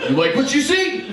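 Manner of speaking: high, cocky flirt line; cheesy flirt. Retold or imitated. To his wife mid-flex, a high cocky flirt. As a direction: show off, pleased with yourself. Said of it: "like I said".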